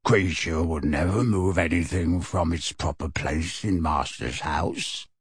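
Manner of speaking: slow, low croaking elf voice; creaky house-elf. Acted. House-elf croaking to Sirius, slow and low. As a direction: bent old servant elf, croaking slowly.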